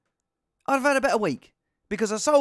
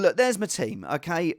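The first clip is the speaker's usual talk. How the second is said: ordinary talk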